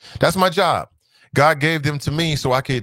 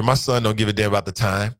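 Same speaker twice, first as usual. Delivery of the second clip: usual speaking voice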